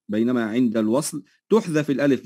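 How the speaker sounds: ordinary talk, nothing marked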